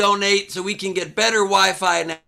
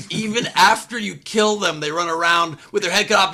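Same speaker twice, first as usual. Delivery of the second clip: normal talking voice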